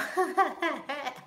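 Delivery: high giggling burst mid-question; high cackle at a funny memory; real laugh. Real, not acted. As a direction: crack up before you can finish. Cracks up recalling comedy show.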